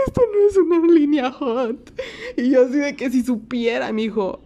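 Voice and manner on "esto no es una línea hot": laughing protest. Retold or imitated. Shocked reply to flirting caller.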